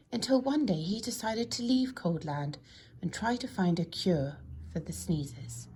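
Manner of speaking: ordinary delivery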